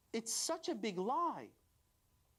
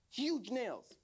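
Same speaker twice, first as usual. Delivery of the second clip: ordinary delivery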